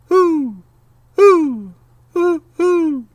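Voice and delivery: high loud hooting owl call; ringing owl hoot into the woods. Acted. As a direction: call out like a great horned owl.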